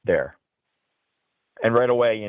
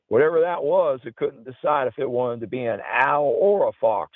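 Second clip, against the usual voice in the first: put-on Southern accent; 3 semitones higher than usual. Quoting friend Steve after the strange call, light Southern accent. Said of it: "whenever I use that accent".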